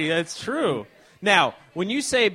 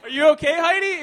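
laughing question, higher and louder; 7 semitones higher, 4 dB louder than usual. Real, not acted. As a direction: ask while cracking up. Heidi chokes, host laughs.

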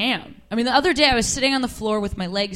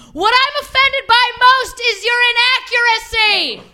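much higher, prim shouted comeback; mock yelled retort; 12 semitones higher, faster than usual. Yelling back at the street gang, prim mock shout. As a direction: yell back with offended precision. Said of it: "what I yelled back was".

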